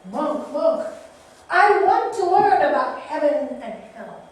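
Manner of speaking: loud, high, demanding samurai call. Acted. Samurai demanding the monk teach him, loud. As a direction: bark it at the monk like a proud warrior. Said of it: "And he goes".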